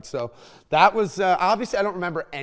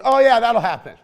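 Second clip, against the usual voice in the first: high, loud imitation of mom; mock-casual mother; mom's breezy reply; 7 semitones higher, 9 dB louder than usual. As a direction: play mom brushing it off, pitched up and louder. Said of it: "She goes".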